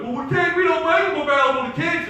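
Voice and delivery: high, loud mocking objection; mock protest. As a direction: protest loudly as someone you are mocking. Mocks objectors to gun restrictions.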